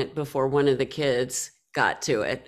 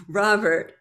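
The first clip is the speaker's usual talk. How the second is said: usual speaking voice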